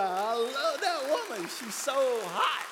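slow, loud, gushing over his wife. Real, not acted. Pastor gushing to the congregation about his bride. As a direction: savor every word about her.

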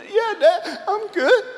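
put-on high child's voice, starting to wobble; a little girl fighting tears; daughter near tears. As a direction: daughter insisting she's fine as dad keeps asking. Daughter wavering toward tears.

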